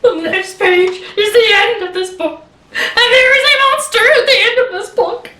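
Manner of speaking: high, loud pleading wail; Grover, scared. Acted. Grover begs reader not to turn last page, terrified pleading. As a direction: build from dread to frantic begging. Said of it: "I am so scared".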